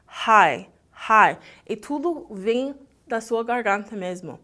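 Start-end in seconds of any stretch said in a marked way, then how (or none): 0.1-1.4 High, high loud with a breathy h from the throat, mouth open. Shown as a demonstration. Teacher demonstrating high loudly for students. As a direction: belt out High with only the throat. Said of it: "nada tá acontecendo aqui na palavra high".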